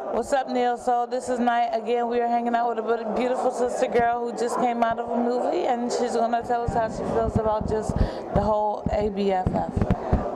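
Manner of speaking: loud, high host announcing; host intro. Acted. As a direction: hype up the intro.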